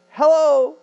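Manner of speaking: a high, loud, joyful hello; bright high greeting. Acted. Mary greeting Elizabeth at the door, a high loud hello. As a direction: call out hello with delight.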